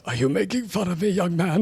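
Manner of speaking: overheard elder. Acted. Game master as an elder confronting a young man, high put-on voice. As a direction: challenge the youngster in a high character voice. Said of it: "you hear, hear a voice".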